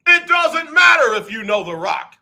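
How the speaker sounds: high, loud shouted catchphrase; The Rock impression. Streamer booming The Rock's line at chat, loud and high. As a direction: bellow it like a wrestling promo. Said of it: "I could be a Rock impersonator".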